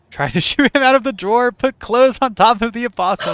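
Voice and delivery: reading through giggles; laughing high and loud. Real, not acted. Laughing reading silly option.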